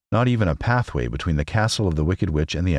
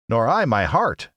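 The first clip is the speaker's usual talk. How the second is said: slow, higher Tin Woodman declaring; declaring tin man; 6 semitones higher than usual. A narrator's reading. Tin Woodman agreeing with Scarecrow, slow declaration. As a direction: state it firmly and slowly. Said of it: "declared the Tin Woodman".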